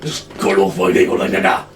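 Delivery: low, slow dark-tongue growl. Acted. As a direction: voice him like an orc of Middle Earth.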